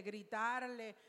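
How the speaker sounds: usual speaking voice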